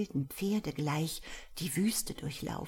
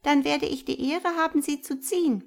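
higher, louder mouse voice; little mouse voice; 9 semitones higher, 4 dB louder than usual. A narrator's reading. The mouse inviting Hjalmar into the thimble, higher and louder. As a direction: ask politely as a tiny mouse.

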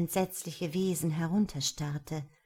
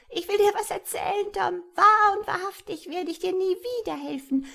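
high, squawky imp voice; spiteful, high-pitched taunting; grinning malicious creature; 9 semitones higher than usual. A narrator's reading. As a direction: gloat in a high goblin voice. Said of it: "sagte es grinsend".

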